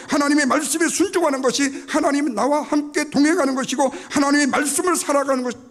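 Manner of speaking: high, loud pulpit proclamation; full-force preaching. Real, not acted. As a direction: build the point at full preaching volume.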